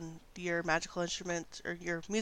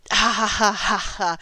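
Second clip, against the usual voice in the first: loud witchy cackle; old hag cackle; 3 semitones higher, 12 dB louder than usual. Acted. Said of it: "a cackle".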